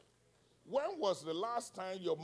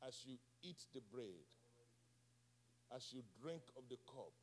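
low, soft, solemn sermon delivery; hushed preaching; 6 semitones lower, 9 dB quieter, faster than usual. Real, not acted. Preacher giving the congregation a simple revelation, hushed preaching. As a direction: drop right down, softly pausing between phrases.

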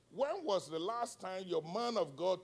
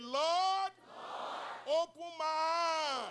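high, loud declaring shout; meaning it; 10 semitones higher, 8 dB louder, much slower than usual. Shown as a demonstration. Preacher leading congregation to repeat the prayer, shouting it with conviction. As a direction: belt it out high like you mean it. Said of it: "Say like you mean it".

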